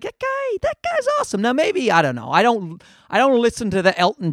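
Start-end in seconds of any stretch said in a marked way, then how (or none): 0.0-1.4 very high, soft gushing voice; mock fan gush. Comedian mimics fans gushing over aging icons, squealing high. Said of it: "people are like".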